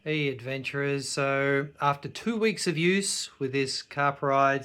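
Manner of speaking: soft, low review narration; calm summing-up voiceover. Acted. Quiet recorded wrap-up voiceover.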